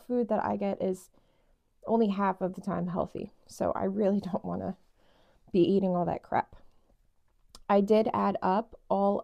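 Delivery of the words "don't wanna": usual speaking voice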